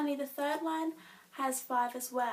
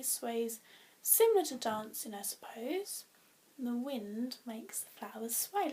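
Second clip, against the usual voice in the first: usual speaking voice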